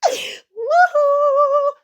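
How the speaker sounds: very high, loud woohoo; triumphant whoop. Real, not acted. After brushing off the critic, a loud triumphant whoop. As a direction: celebrate out loud after laughing.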